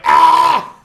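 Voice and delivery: short loud yell. Acted. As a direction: belt out one sharp yell. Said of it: "So you go".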